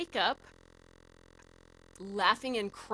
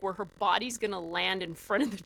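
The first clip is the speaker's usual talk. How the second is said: ordinary talk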